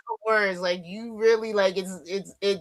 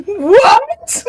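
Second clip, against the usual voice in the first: shocked laughing what; 12 semitones higher, 7 dB louder than usual. Real, not acted. Shocked by the king story.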